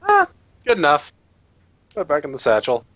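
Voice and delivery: ordinary talk, nothing marked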